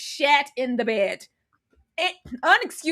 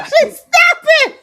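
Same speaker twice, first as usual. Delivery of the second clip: loud high shout through laughter; laughing shout; 10 dB louder, slower than usual. Real, not acted. Cohost begging Ashley to stop, laughing shout. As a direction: beg her to stop while cracking up.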